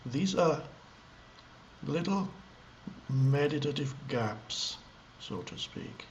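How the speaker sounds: halting, spacious delivery with lengthy pauses mid-sentence; meditative gaps. Shown as a demonstration. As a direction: leave long silences between short phrases. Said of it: "small gaps between words as I talk".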